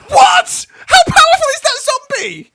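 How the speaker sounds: high, loud shocked yells; shocked shouting. Real, not acted. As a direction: yell in disbelief at the zombie. Killed by a zombie.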